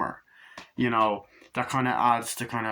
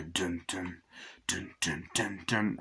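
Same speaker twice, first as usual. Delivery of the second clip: low, soft hummed dun dun of the score; hummed film score; 4 semitones lower, 7 dB quieter, slower than usual. Retold or imitated. Reviewer imitating the film's music to viewers, low soft humming. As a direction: hum the menacing theme quietly, low. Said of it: "and the music".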